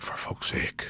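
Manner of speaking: hushed unvoiced whisper in the dark shop; a scared hushed whisper to himself. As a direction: whisper it, spooked. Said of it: "I was kinda going like".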